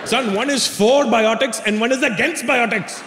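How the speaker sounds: imitated dad's Indian accent; explaining Indian dad. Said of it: "My dad goes".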